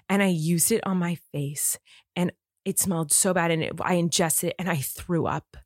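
low-pitched imitation of a serious husband; really serious. Husband asks wife for another towel, really serious. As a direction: imitate him, grave and understated. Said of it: "he was, like, really serious".

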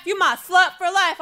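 high, punchy rap chant; rapper over loudspeaker. Retold or imitated. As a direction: imitate an aggressive rap hook. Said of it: "I hear over the loudspeaker".

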